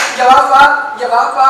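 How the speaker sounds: loud call to a brother. Acted. Kumbhakarna calling his brother Vibhishana to come. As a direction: shout for him to come close.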